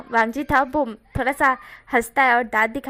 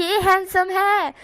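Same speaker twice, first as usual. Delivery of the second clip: slow insisting; 7 semitones higher, 4 dB louder, much slower than usual. Retold or imitated. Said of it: "Chang कहता".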